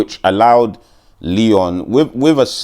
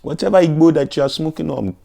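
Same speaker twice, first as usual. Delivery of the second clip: mock Jamaican accent; 8 semitones higher than usual. Mocking Leon Edwards in a Jamaican accent. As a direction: tease the fighter in a Jamaican accent. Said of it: "he fights like a Jamaican boy".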